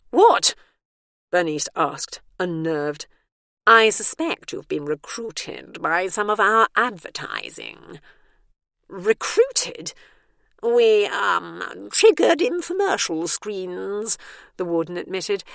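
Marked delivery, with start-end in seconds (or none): 0.1-0.5 much higher, louder second what; alarmed what. Acted. Bernice unnerved, repeats question. 3.7-14.2 slow, lightly voiced official warden; a hint of a smooth official. A narrator's reading.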